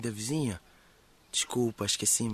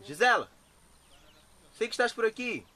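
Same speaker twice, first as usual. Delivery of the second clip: calling out; 11 semitones higher, 4 dB louder than usual. Acted. Calling for a friend nearby.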